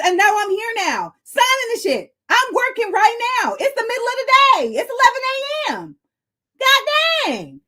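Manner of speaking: venting, voice way up; worked-up high complaint; high-pitched rant. Real, not acted. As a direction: keep ranting in high outrage.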